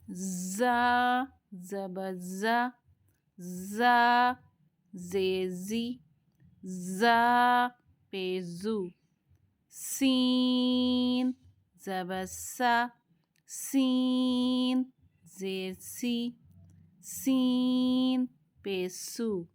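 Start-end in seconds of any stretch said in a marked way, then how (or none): 0.1-7.7 ज़ा held long with a buzzing z; drilled zaa. Shown as a demonstration. Teacher drills ज़ा slowly with students. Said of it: "थोड़ी सी practice कर लेते हैं". 9.7-19.5 सीन stretched, light thin hiss; thin seen drill. Shown as a demonstration. Teacher drills thin सीन syllables slowly. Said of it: "इन्हें बारीक ही पढ़ेंगे".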